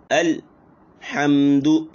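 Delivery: अल then a break before हमदु; pause in word wrong. Shown as a demonstration. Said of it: "इस तरीके से नहीं पढ़ना है".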